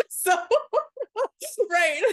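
bursting into laughter mid-word; high, loud laughing fit; laughing hard. Real, not acted. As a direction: crack up laughing. Laughing at her own joke.

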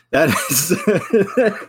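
giggling, high and breaking; stifled giggles. Real, not acted. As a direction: laugh breaking through. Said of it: "I'm trying not to laugh".

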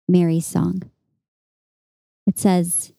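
usual speaking voice

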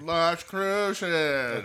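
high, loud character catchphrase; Carlton Blanchard. Acted. Host reminding listeners of a sitcom character, loud impression. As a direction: call it out high and stretched, as an impression. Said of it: "for those of you who don't remember Carlton Blanchard".

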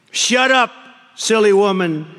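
high, loud sneering retort; snake snapping back. Acted. The snake snaps at the tender woman, loud and sneering. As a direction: bark it at her with a grin. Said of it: "Said the reptile with a grin".

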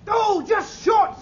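shouting. Acted. Reacting to shot falling short.

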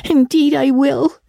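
put-on high, tearful little-girl promise; sobbed. A narrator's reading. Diana promises her parting friend, sobbing. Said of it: "sobbed Diana".

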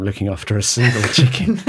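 giggling through the repeat; laughing. Real, not acted. Host repeating the chicken line, laughing. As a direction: laugh it out in disbelief.

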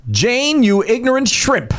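high, slow, theatrical line delivery; master thespian reading. Acted. Actor reads a famous quote to contestants, theatrically. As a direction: declaim it like a TV catchphrase. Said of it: "Let's go to Master Thespian".

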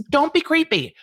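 scolding. Real, not acted. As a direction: snap the warning. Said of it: "host scolding".

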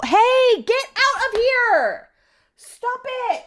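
high, drawn-out yell; scolding the dogs. Real, not acted. Owner interrupting herself to scold dogs, high yell. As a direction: call out sharply to break it up. Yells at her dogs mid-story.

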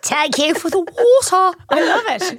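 high, slow mimicry of a Dublin toddler; Dublin accent. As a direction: draw it out like a little Dublin child. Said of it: "such a Dublin accent".

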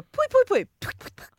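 high, loud mock spitting "pui pui pui" that fades away; spitting noises. Imitating ritual spitting to ward off evil spirits, high and loud. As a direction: spit the evil away, playfully. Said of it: "evil spirits who go".

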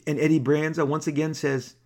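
usual speaking voice

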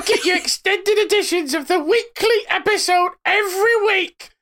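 high laughing cartoon voice; silly high voice. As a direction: read the ad like a squeaky cartoon. Comic voice reading the ad.

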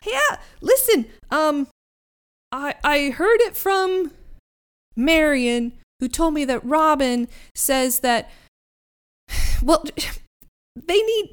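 high eager boat voice; chatty, earnest friendly boat; goofy Herman voice. Acted. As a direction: Herman urging them to camp, high and goofy. Herman voice held.

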